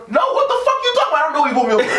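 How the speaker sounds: high, fast mock outrage; mock indignant. Driver talking back to a cop, mock indignant. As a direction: play the clueless driver, offended.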